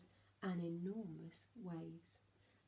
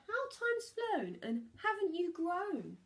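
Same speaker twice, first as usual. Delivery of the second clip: flock greeting; 9 semitones higher, 4 dB louder than usual. A narrator's reading. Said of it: "who said".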